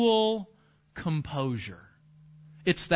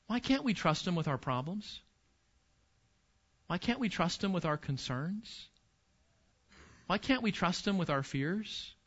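soft rhetorical questions; 3 semitones lower, 10 dB quieter than usual. Real, not acted. Pastor pleading softly with the congregation.

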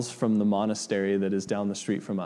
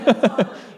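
short, high, loud burst of laughter; real laughter; 9 semitones higher, 6 dB louder than usual. Real, not acted. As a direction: laugh at your own joke. Laughing at own joke, just kidding.

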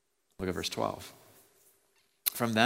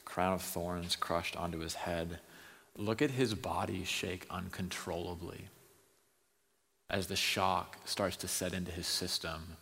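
hushed preaching; 5 dB quieter than usual. Acted. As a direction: draw the congregation in with a hush. Preacher describes Jesus's suffering.

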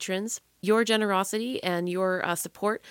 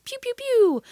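high, loud pew pew lasers; laser sound effect; 6 dB louder than usual. Pretend. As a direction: shoot rainbow lasers with your voice. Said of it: "those were rainbows shooting out of my eyes".

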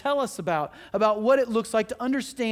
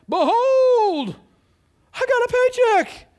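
high, shouted mock herald's cry; mock proclamation; 14 semitones higher, 8 dB louder, slower than usual. Preacher mocking excitement over a paycheck, shouted high and grand. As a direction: proclaim it hugely, hammed-up excitement.

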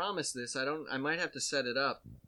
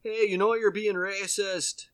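louder, higher polite scolding; mock Minnesota scolding; 6 semitones higher, 8 dB louder than usual. Minnesotan man to mask couple, mock polite scolding. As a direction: scold like a nice Midwesterner. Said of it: "who's just like".

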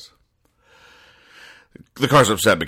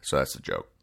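usual speaking voice

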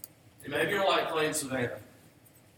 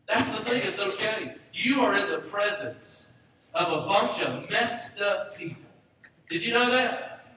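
raised, higher, louder preacher's call to the room; fervent preaching; 6 semitones higher, 4 dB louder than usual. Acted. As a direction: preach it out to the whole congregation. Pastor preaching at full force.

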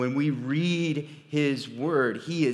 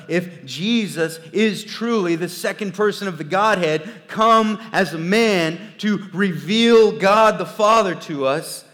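rising, louder preacher's proclamation; fervent preaching; 7 semitones higher, 11 dB louder than usual. Acted. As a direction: build it like a pulpit climax. Preaching rises to full force.

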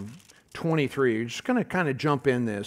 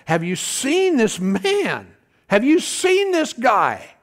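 awed gawking; 6 semitones higher, 3 dB louder, slower than usual. Acted. Men of Israel gawking at Goliath.

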